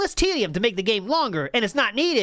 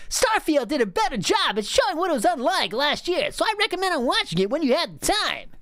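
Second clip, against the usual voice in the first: higher, nasal-free nerdy imitation; annoying nerd guy; 8 semitones higher than usual. Reading the message as an annoying young nerd, higher pitched. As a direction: play a young know-it-all nerd. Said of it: "younger kinda annoying nerd guy".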